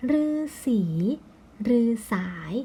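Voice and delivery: long ue in "รือสี" and "รือสาย"; model reading. Shown as a demonstration. Teacher reading out words, careful list pronunciation. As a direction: read "รือสี", pause, "รือสาย", rising tone on the last syllables. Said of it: "อ่านว่า".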